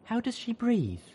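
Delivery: high, aghast question. Acted. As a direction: ask it horrified. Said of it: "Velvet wondered, aghast".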